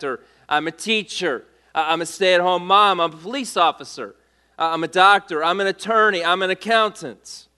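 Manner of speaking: ordinary delivery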